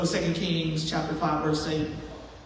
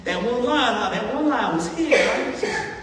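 smug pastor; 4 semitones higher than usual. Retold or imitated. Pastor with a big head.

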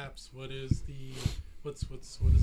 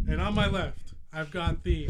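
wrestling announcer; 9 dB louder than usual. Acted. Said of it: "I'm trying to do a wrestling promo".

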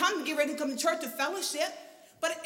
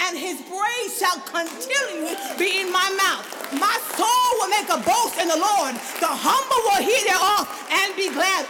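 full-force preaching; 4 semitones higher, 8 dB louder than usual. Acted. Pastor proclaiming the psalm to the church, loud preaching. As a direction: shout the psalm over the music.

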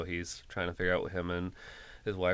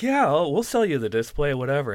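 loud offhand; 7 semitones higher, 7 dB louder than usual. Retold or imitated. Store worker gives in.

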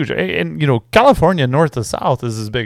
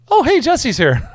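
mock casual reply; 11 semitones higher, slower than usual. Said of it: "but instead it's just like".

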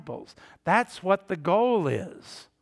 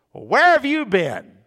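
sharp, pointed "where have you been", higher and louder; snide; 6 semitones higher, 8 dB louder than usual. Retold or imitated. Churchgoer needling a lapsed member at a funeral, snide. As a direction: needle them with a prying question.

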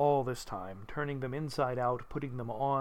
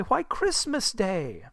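slow, higher, incredulous answer; astonished boy; 6 semitones higher, slower than usual. Acted. Boy answering Scrooge from below, slow wondering reply. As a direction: state the obvious with boyish wonder. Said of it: "replied the boy".

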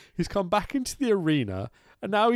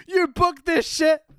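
ordinary talk

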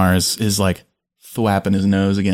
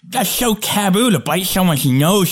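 high, scandalised scolding; mock-offended high voice; indignant; 8 semitones higher than usual. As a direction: clutch your pearls. Character scolds nose biter.

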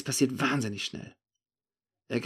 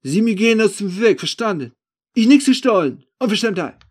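high, very loud outraged denial; angry protest; 10 semitones higher, 12 dB louder than usual. Acted. The accused man ordering the narrator aside, angry protest. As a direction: shout back in broken German, affronted. Suspect angrily denies the theft.

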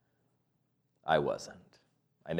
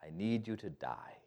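quiet answer; 16 dB quieter than usual. Shown as a demonstration. Softly giving the proper answer to Jesus. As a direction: hush it like a solemn confession. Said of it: "is the proper answer".

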